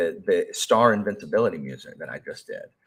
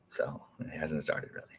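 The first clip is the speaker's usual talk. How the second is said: whispered; 4 semitones lower, 10 dB quieter than usual. Real, not acted. Aside to chat.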